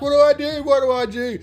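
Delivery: very high, loud panicked plea; freaking out. Retold or imitated. Leech-covered guy begging friends for help, high panic. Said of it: "he's freaking out, man".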